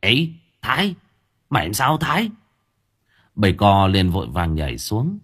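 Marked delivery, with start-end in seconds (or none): 0.0-2.3 higher, startled calling out his name; alarmed call. Acted. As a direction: rush toward him, alarmed. Alarmed at seeing haggard Thái.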